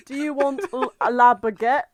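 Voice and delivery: mock French accent asking about baguettes; dodgy French accent. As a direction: play a bad French waiter. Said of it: "the dodgy accents".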